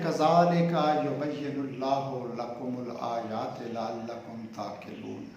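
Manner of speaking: slow, devout reading of the verse; Quran recitation. Acted. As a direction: recite the verse slowly and reverently.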